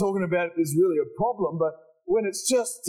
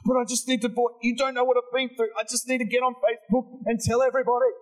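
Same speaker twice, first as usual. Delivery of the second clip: high, insistent mock whine; hammed-up high, urgent pleading; pleading protest; 6 semitones higher than usual. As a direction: play someone who just has to complain. Acted protest of a complainer.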